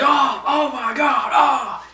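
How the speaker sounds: high, loud, drawn-out yelp; mock pain shout. Boss whacked in the knee, hammed-up pain shout. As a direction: yelp like the crutch just hit. Boss hit with crutch again.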